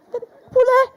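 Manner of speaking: high shout of poulet; sudden comic yell. Acted. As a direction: blurt it out loud and high. Shouting the food name.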